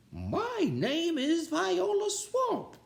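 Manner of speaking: very slow, high put-on witchy teacher voice; menacing substitute. A narrator's reading. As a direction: drag out each word to intimidate. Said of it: "said the lady".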